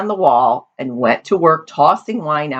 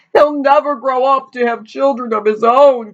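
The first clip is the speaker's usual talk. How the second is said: high, mournful cry; 9 semitones higher than usual. A narrator's reading. Father mourns his imagined grandson, crying. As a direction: wail it in exaggerated grief. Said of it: "cried the father".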